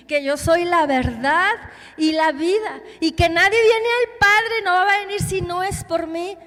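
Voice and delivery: ringing preacher's declaration; forceful proclamation. Retold or imitated. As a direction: proclaim it as Jesus, building in force. Preacher voices Jesus proclaiming.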